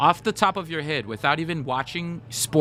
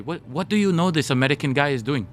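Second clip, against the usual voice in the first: put-on Japanese accent, questioning. Coach quizzing the interviewer about the American, imitated Japanese accent. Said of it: "I'll do my Japanese accent".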